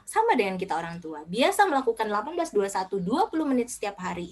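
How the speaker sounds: normal talking voice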